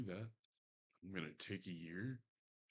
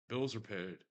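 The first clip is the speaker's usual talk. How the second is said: ordinary talk, nothing marked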